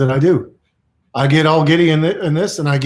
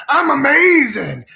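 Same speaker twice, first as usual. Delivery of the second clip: very high, louder, drawn-out exultation; really feeling it; 11 semitones higher, 5 dB louder, slower than usual. Shown as a demonstration. Urging listeners to say it along, soaring exultation. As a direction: soar up and stretch it out. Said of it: "Really feel it now".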